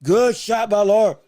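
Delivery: slow, loud ringside call. Real, not acted. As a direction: belt out the landed shot. Commentator calls a landed punch.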